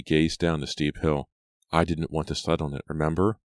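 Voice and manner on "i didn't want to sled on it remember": usual speaking voice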